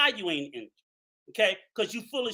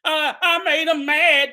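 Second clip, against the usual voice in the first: slow, higher mock excuse; mock battered spouse; 4 semitones higher, 4 dB louder than usual. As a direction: mimic a victim making excuses. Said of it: "like a battered spouse".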